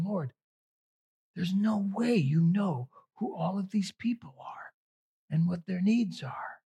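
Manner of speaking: hushed, half-whispered doubt; disbelieving thought, breathed under the breath; inner prayer. Retold or imitated. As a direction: whisper it privately up to God. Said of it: "in my mind, I'm thinking".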